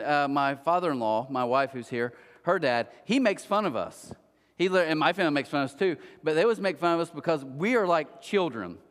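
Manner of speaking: ordinary talk, nothing marked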